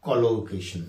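ordinary delivery

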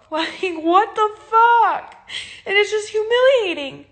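real sobbing frustration, dragged out; crying. Real, not acted. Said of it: "I'm sorry that I'm crying".

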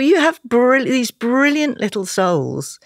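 close to tears. Real, not acted. Guest talking about struggling dyslexic kids, close to tears. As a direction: voice pitched high and strained, close to tears. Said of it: "Now you're gonna make me cry".

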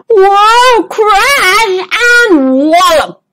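high startled whoa, then slow crash; a zebra tripping over mid-sentence; tumbling cry. Acted. Zoe trips and falls.

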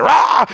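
loud, high roar of a football fan; excited fan's roar. Retold or imitated. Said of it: "he gets excited".

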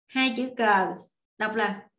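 ordinary talk, nothing marked

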